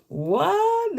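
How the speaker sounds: high-pitched incredulous "what"; shocked disbelief. Retold or imitated. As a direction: react in disbelief, pitch leaping up. Retelling her shocked reaction.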